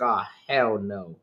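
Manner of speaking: retold hell-no dying out into near silence; exasperated refusal, fading. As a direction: start fed up and let each no drain away until it's barely there. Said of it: "I was like".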